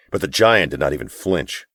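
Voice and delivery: plain reading voice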